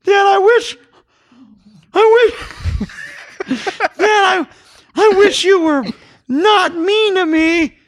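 put-on hurt little boy, very high and loud; Jamie. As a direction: wail brokenly at Dad in a child's voice. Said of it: "I love my Jamie impression".

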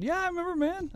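very high, soft fake "I remember"; very high soft fake recognition. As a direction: squeak a nervous lie softly. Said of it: "when I said".

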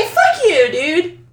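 high, loud mock outrage; playful outburst. Real, not acted. Cohost snaps back at the host, loud and high. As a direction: yell it at him half-joking.

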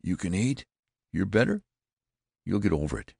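high, anxious relief; eager, relieved questioning; crying out. A narrator's reading. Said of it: "cried out with a voice".

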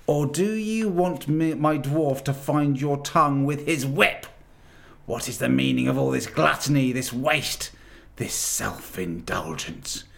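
furious witch. A narrator's reading. Witch queen berates the silent animals, high and furious. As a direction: spit the words at them. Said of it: "she said again".

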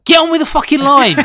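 furious yell to hurry up; angry shout. Real, not acted. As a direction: shout it out in frustration. Impatient host yells at speaker.

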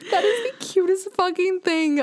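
high, slow cooing gush. Retold or imitated. Vlogger recalling gushing over the dancing robot, high and slow. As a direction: melt over something adorable.

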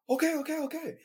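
retold panicked compliance; scared okay. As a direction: sound frightened of the gun. Terrified reply to armed cops.

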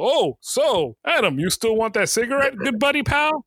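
badly acted friendliness. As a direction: play it obviously staged and pally. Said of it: "With the best, the best acting".

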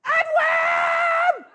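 high, drawn-out holler; mother's shout. Retold or imitated. Puerto Rican mom from the stands, shouting. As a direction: holler the name across a ballpark. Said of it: "she had such a thick accent".

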